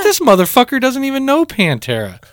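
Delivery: high-pitched incredulous complaint; retelling indignant inner rant; retold exasperation. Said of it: "And I'm like".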